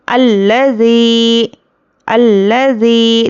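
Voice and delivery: slow chanted tilawat; Quran recitation. Shown as a demonstration. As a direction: recite slowly with long vowels. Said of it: "teacher recites". (धीमी तिलावत)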